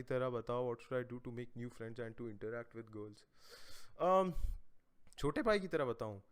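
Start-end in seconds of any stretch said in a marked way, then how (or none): none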